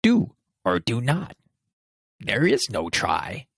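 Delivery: a playful nod to Yoda, not a full character voice; Yoda impression. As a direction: do a quick Yoda, then draw out there is no try. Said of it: "my Yoda impression".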